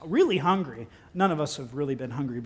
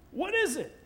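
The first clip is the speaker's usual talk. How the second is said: puzzled question; 12 semitones higher than usual. Acted. Acting the puzzled Israelites.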